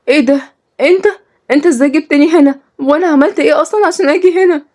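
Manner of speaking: high, alarmed questioning; acted panic of a captive. Captive confronts her kidnapper.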